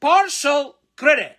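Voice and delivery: Partial credit said slowly, high and loud; slow emphatic reading. Shown as a demonstration. Teacher repeating the phrase slowly and loudly. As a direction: stretch out Partial credit for the class. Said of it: "Par يعني جزء".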